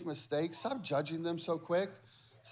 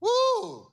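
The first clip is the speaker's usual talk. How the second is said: excited whoop; 6 dB louder than usual. Real, not acted. Preacher's exclamation.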